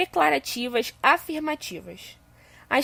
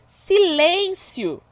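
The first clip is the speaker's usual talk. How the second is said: drawn-out example word; 5 semitones higher, much slower than usual. Shown as a demonstration. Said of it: "quando eu digo".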